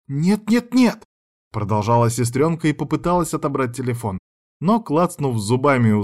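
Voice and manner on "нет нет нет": high, rising string of refusals; frantic protest. A narrator's reading. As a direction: protest in a much higher voice. Sister protests, grabbing the phone.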